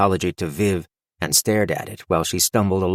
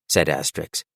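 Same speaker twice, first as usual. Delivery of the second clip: ordinary delivery, nothing marked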